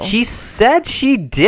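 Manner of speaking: high, loud, drawn-out protest; insistent shout. Real, not acted. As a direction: shout it slowly to shut down the doubt. Insisting loudly against co-host's doubt.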